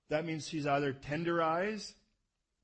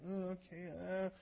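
mock reluctant, higher hesitant mumble; reluctant host; 4 semitones higher, 4 dB quieter, slower than usual. Host answering a guest who outstays his welcome, mock reluctant. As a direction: imitate a host uneasily putting up with it.

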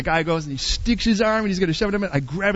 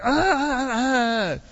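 freaked-out cries; 3 semitones higher, much slower than usual. Retold or imitated. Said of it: "I was like".

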